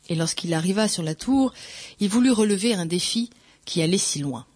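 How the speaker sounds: usual speaking voice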